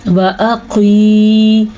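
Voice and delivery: व अक़ी with short first vowels and a very long final ई; drawn-out madd. Shown as a demonstration. Teacher joining the syllables, अक़ी stretched long. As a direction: hold the end of अक़ी on a level tone. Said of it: "ये खींच के पढ़ेंगे".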